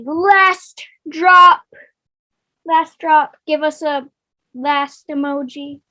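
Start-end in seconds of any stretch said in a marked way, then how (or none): 0.0-1.6 slow, loud drawn-out announcement; announcer. Acted. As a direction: boom it out like a host. Announcer reveal, then plain repeat.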